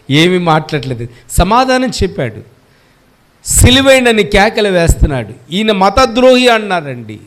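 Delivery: ordinary talk, nothing marked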